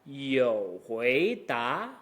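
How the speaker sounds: low "You" gliding up into "hui"; slow dip on "You", then rising "hui da"; third supertone. Shown as a demonstration. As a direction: link "You hui" as one dip-and-rise. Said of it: "that third supertone".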